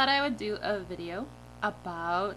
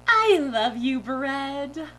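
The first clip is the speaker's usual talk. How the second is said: higher, loud gushing declaration; silly dream-self voice; 5 semitones higher, 9 dB louder, much slower than usual. Retold or imitated. Driver professing love to a bag of bread, silly gushing voice. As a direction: croon it adoringly at full volume. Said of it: "I sounded like that too".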